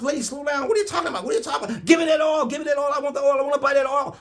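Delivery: high, loud shouted back-and-forth; shouting. Retold or imitated. Frantic nurse and preacher shouting over the oil, high and loud.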